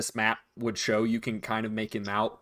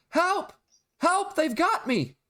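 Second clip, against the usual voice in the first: put-on very high-pitched child's cry for help; small child yelling; slower than usual. Game master as a child calling from the forest, high yell. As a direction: cry out like a little kid in trouble. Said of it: "the voice of a small child that yells".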